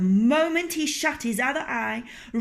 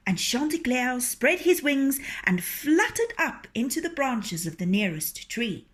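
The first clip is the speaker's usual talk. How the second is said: ordinary delivery, nothing marked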